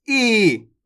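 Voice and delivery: "ई" drawn out as a long ee; stretched long ee. Shown as a demonstration. Teacher shows the big vowel, "ई" pulled long. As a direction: hold "ई" for extra time. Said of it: "ज्यादा समय लग रहा है".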